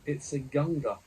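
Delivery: loud excited shout. A narrator's reading. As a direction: call it out loud. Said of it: "shouts Heston Gurgle".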